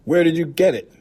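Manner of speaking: high, loud suspicious demand; piercing, accusing question; sharp questioning. A narrator's reading. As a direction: demand an answer, pitched right up. Aunt questions the odd coin.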